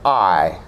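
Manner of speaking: "I" as a gliding "ah-ee"; vowel demo. Shown as a demonstration. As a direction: glide "I" from ah to ee. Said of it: "this one here is I".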